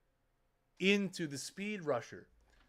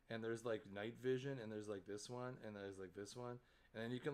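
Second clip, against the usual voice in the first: ordinary talk, nothing marked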